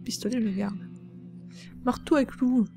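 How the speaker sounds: usual speaking voice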